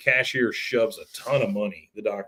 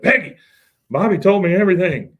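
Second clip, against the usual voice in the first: loud, high, urgent outburst; confronting shout; 7 semitones higher, 6 dB louder than usual. Acted. Husband busting in on his wife, accusing shout. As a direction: burst in and call her out.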